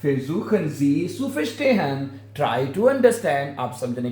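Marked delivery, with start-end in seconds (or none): 0.1-2.1 Versuchen Sie zu verstehen, drawn out very slowly; slow demo. Shown as a demonstration. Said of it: "क्या बनेगा?". (धीरे धीरे पूरा जुमला)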